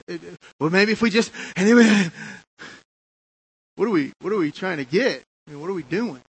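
fervent preaching. Real, not acted. Preacher challenging the congregation about busyness. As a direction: plead with the crowd, full force.

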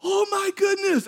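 very high imitation of her amazement; astonished squeal. Woman finds her ankle healed, high amazed squeal. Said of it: "and her eyes get big".

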